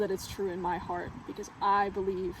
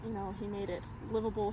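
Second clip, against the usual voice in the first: ordinary delivery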